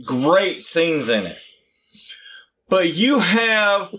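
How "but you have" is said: high-pitched, drawn-out rallying cry; fired-up ranting. Real, not acted. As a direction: stretch each word out high in mock outrage. Heated defence of favourite film.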